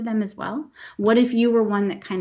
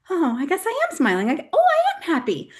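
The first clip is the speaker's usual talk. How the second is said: high-pitched dawning realization; pleasant surprise; 9 semitones higher than usual. Acted. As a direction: act out suddenly finding you're happy, voice leaping up. Acting out surprised discovery of happiness.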